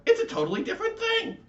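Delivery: high, loud indignant insistence; crying out. A narrator's reading. The latke corrects the candy cane, loud high cry. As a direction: cry it out, refusing to be lumped in. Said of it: "cried the Latke".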